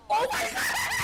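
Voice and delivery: high, drawn-out laughing scream; shocked laughing shout. Real, not acted.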